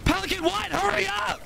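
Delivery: high calling out for the dropship; urgent high call for evac. Real, not acted. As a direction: yell up to the pilot to hurry.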